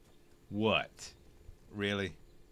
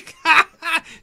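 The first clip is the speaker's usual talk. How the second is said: big high laugh after the joke; loud burst of laughter; 14 dB louder than usual. Real, not acted. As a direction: crack up loudly.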